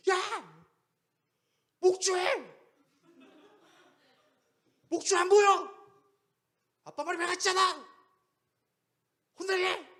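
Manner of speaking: high, loud mock-furious shouting; angry yelling. Imagined father threatening his scared son over the leash, angry yelling. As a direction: bellow separate bursts like an enraged parent.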